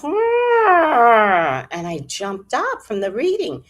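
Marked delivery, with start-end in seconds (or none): 0.0-1.7 high, loud imitated cat meow; the cat answers John with a loud high meow. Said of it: "the cat goes".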